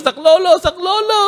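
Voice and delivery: high panicked cry for help; Tagalog: sigaw ng takot; screaming for help. A narrator's reading. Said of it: "Nagsisigaw siya".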